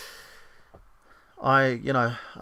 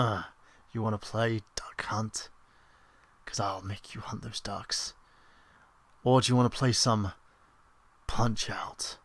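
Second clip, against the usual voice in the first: creepy voice; 4 dB quieter than usual. Shown as a demonstration. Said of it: "I have the most perverse and creepy voice".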